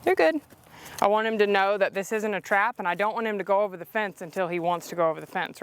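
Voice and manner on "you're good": bright high-pitched reassurance; high praise to horse. Real, not acted. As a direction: lift the voice to cheer the horse on. Reassuring horse over fence.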